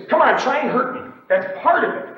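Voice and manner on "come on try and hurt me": high, loud taunt; challenging dare. Shown as a demonstration. Trader's voice inviting others in the pit, loud challenging dare. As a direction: dare them to take you on. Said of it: "something in your voice that says".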